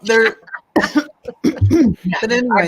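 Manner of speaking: coughing through a laugh; choking on nothing, laughing. Real, not acted. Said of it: "I'm choking on nothing".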